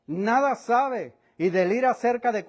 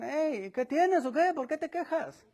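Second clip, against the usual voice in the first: sarcastic taunt; 7 semitones higher, 4 dB quieter than usual. Acted. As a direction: pretend not to know what's wrong. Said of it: "Es manera burlona, sarcástica, finge no saber". (burla sarcástica y lenta)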